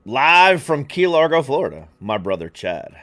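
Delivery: slow, loud showman announcing of live from Key Largo; big hammed-up guest introduction; announcer intro. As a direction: bring the guest on like a TV host.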